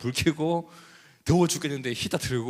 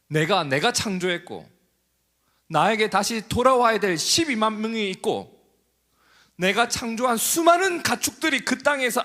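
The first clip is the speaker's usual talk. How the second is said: God's rising rebuke; 7 semitones higher, 5 dB louder than usual. Acted. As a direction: start gentle, swell into a loud appeal. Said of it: "그분의 마음을 보여줘요".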